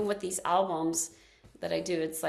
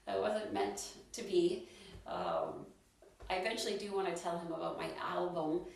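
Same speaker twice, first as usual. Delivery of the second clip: usual speaking voice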